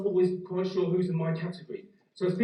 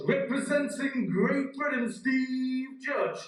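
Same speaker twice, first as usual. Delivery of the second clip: slow, higher, drawn-out announcer call; imitating the race announcer; stadium announcer; 6 semitones higher, much slower than usual. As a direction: ring it out like the PA at the start line. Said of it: "then the announcement comes".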